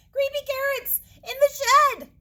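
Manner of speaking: high, loud terrified shout; panicked scream. Acted. Bunny screaming to mom about carrots. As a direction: yell it to warn mom. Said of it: "Jasper screamed".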